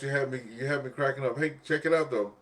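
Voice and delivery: ordinary delivery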